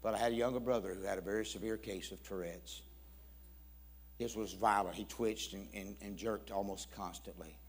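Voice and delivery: low, soft, grave account; subdued retelling, sinking at the end; somber. Retold or imitated. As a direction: tell a tragedy quietly and with weight. Brother's suicide, retold soberly.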